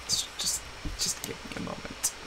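voiceless, asking for a moment; whispered plea. Real, not acted. Mourning the sled, whispered defeat.

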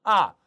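아 falling sharply from high; fourth tone. Shown as a demonstration. Teacher demonstrating the fourth tone of 아, falling. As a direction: snap 아 down hard from the top. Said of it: "사성".

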